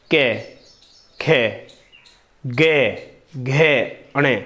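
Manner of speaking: कै, खे, गे, घे said one by one, slowly and clearly; consonant sounds demo. Shown as a demonstration. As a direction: sound out कै खे गे घे for the class. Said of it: "जैसे हम उच्चारण करते कै". (व्यंजनों का धीमा, साफ़ उच्चारण)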